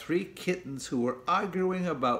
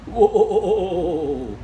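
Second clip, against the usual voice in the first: loud drawn-out spooky wail; expressive wail; 6 semitones higher, 8 dB louder than usual. A narrator's reading. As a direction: give the cave a big ghostly moan. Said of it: "with a lot of expression".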